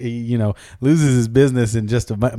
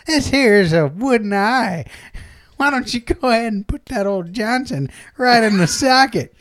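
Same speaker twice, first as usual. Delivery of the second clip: put-on higher-pitched folksy old woman; old madam; 7 semitones higher than usual. Old madam offering her wooden-eye socket, in an old-woman voice. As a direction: present the offer like a proud crone. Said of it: "she's all old".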